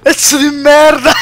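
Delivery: yelling an insult through laughter; laughing, half-shouting. Real, not acted.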